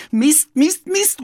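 high, loud cursing in frustration; a boy stamping his foot, Mist Mist Mist; fed-up schoolboy yelling, pitched high; frustrated cry. Acted. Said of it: "ruft Jonathan".

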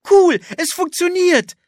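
overjoyed at the magic; thrilled delight. Acted. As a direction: invisible girl cheering that the cloak works, high and loud. Anne thrilled cloak works.

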